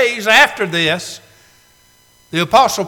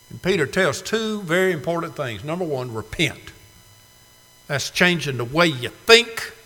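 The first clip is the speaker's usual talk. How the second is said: ordinary delivery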